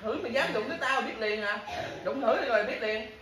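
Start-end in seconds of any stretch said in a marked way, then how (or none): 0.0-1.4 angry dare. Real, not acted. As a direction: dare him to try it. Angry dare during a quarrel. (thách thức quát to)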